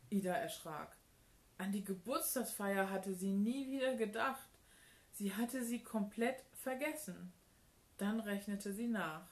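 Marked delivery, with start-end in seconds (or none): none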